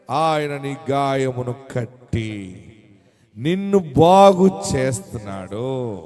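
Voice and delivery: slow, low, drawn-out promise; solemn reassurance. Real, not acted. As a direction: drop low and comfort them, stretching the words. Comforting promise after the acted lament.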